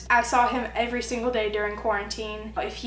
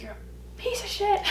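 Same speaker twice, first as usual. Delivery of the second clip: mock angry insult; 10 semitones higher, 5 dB quieter than usual.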